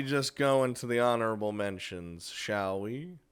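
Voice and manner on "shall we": normal talking voice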